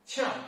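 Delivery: short sharp drop on "qiang"; fourth tone. Shown as a demonstration. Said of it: "fourth tone".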